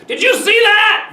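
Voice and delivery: very high, loud excited cry; exclaiming. A narrator's reading. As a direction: burst out with sudden loud excitement. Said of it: "he exclaimed".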